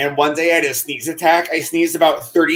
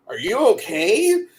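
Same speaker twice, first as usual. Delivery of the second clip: high-pitched mock concern, rising question; high retold check-in, lifting at the end; imitating concerned people; 7 semitones higher than usual. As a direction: ask it high, like worried onlookers. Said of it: "Except for they say in the country accent".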